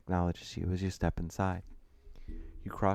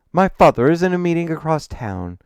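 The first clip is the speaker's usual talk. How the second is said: raised, louder voice with an edge of annoyance; annoyed; 9 semitones higher, 12 dB louder than usual. A narrator's reading. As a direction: let the annoyance show through. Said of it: "his tone betraying a sense of annoyance".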